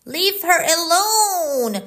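loud, high shout. A narrator's reading. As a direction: yell to save the cat. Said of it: "shouted Dick".

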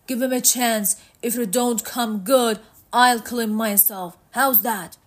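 a hint of character voice, firm and insistent; lightly voiced Matt, bargaining.

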